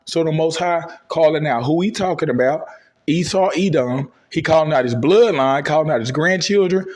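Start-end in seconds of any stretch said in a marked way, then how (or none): none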